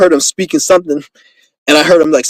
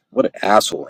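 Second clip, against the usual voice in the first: low, soft muttered insult; under the breath; 7 semitones lower, 8 dB quieter than usual. Acted. Pretending they aren't meant to hear it, muttered under the breath. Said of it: "I'll whisper something under my breath".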